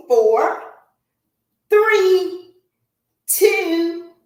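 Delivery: high, loud call-outs of each count; coach counting reps. Real, not acted. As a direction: pump the class up with every number.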